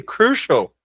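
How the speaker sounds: ordinary delivery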